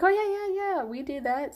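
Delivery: bright mock-eager phone answer; eager receptionist.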